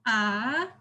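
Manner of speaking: AH with a rising pitch; second tone. Shown as a demonstration. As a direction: let "ah" climb upward like a question. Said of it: "The second tone is rising".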